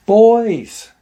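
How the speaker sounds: loud, high drawn-out call that falls away; retold yell. Aunt yells from the deck for the boys, calling out. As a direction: imitate her yelling for the kids. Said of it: "She yells for 'em to come".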